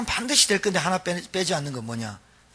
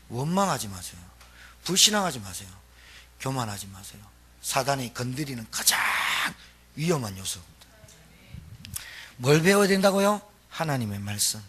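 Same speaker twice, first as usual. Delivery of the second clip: ordinary talk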